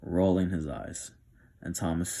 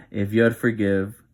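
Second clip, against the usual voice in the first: breaking into a real laugh on "if you'd forgive", louder; 3 semitones higher, 9 dB louder than usual. Real, not acted. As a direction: laugh through the words, losing composure. Narrator laughs on retake.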